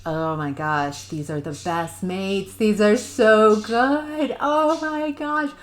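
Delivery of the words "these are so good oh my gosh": high, loud, drawn-out delight; excited gushing. Real, not acted. Reader thrilled by the mates she foresees, excited gushing. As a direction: gush with rising excitement.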